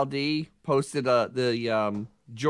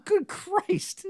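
shocked. Real, not acted. Shocked at the price.

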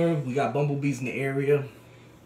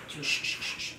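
soothing shush; 6 dB quieter than usual. Real, not acted. Soothing the hurting dog.

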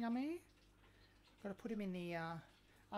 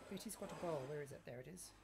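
ordinary delivery

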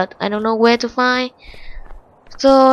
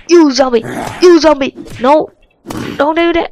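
loud panicked yelling at a zombie; 7 semitones higher, 6 dB louder than usual. Real, not acted. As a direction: shout it out in a panic.

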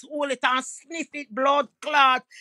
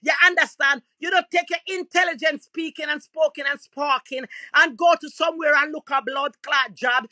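normal talking voice

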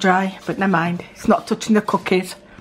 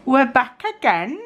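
ordinary delivery

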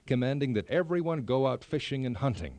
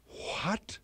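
shocked; 6 semitones higher, 4 dB quieter than usual. A narrator's reading. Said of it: "the king exclaimed".

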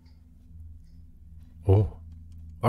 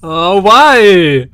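startled; 4 semitones higher, 9 dB louder than usual. Real, not acted. Startled in game.

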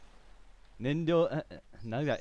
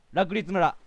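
village name called out, louder and higher; excited exclamation; 6 semitones higher, 5 dB louder than usual. Real, not acted. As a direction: shout the name in delight.

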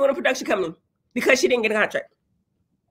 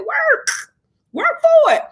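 emphatic shout; 14 semitones higher, slower than usual. Real, not acted. Urging viewers to work hard.

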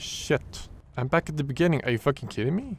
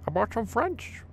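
mock fish voice; 14 semitones higher than usual. Voicing the fish Happy.

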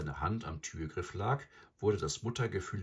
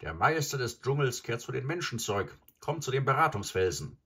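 high, raised herald's cry; calling out; 7 semitones higher, 5 dB louder than usual. A narrator's reading. Graubruder summons the jungle to the council rock, calling out. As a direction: proclaim it high to the whole jungle. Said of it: "rannte Graubruder mit dem Ruf".

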